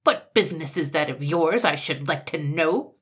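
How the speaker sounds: loud, testy retort; irritable and forceful; snapped. A narrator's reading. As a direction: snap back indignantly at a nosy shopkeeper. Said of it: "snapped Sir Buzz".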